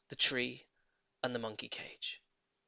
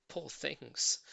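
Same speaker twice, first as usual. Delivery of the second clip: ordinary delivery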